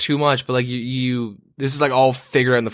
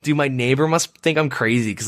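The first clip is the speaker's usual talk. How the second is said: normal talking voice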